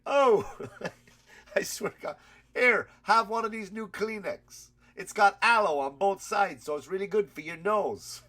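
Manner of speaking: loud, high-pitched mobster quote, half laughing; loud mock sales pitch. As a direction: blurt it out like an absurd product plug. Acted Paulie pitching tissues to crying girl.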